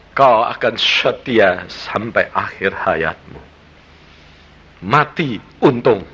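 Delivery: usual speaking voice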